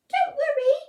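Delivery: high, loud squeaky peep; a tiny voice reassuring a giant; mouse peeps. Acted. Mouse comforting the scared lion, high loud peep. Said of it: "Mouse peeped".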